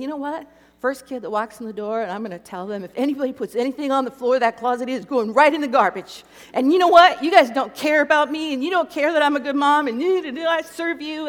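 fast, loud and high, building a furious scolding; rehearsed angry speech. Retold or imitated. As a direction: lecture the kids in your head, ramping it up. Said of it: "making my speech in my head".

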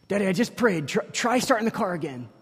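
she's prayed, now urge Daddy to try; hopeful little girl. Retold or imitated. Daughter voiced, hopeful pleading.

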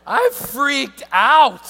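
loud, slow panicked outburst; hammed-up panic; freaking out. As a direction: blurt it out in mock horror. Panicked preacher retelling scare.

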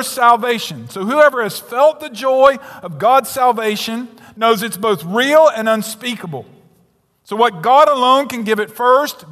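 loud, high preaching that pounds each key word; fervent sermon voice; full-force preaching. Acted. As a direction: drive every line home from the pulpit.

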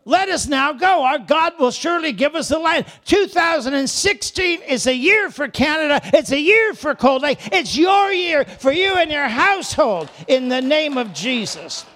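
loud, high preacher declaring; ringing sermon declaration; full-force preaching. Acted. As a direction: proclaim it over the crowd.

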